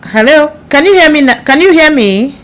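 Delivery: loud, high calling out; to someone hard of hearing. Real, not acted. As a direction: call out to a faint caller. Calling to silent guest on live.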